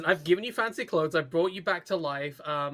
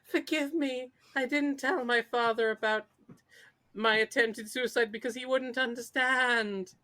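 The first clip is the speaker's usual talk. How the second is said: higher-pitched put-on apology; mock contrition; 5 semitones higher than usual. Mimicking her audacious plea to Daniel Jackson, mock pleading. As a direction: plead for forgiveness in a mocking high voice. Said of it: "this fucking bitch to be like".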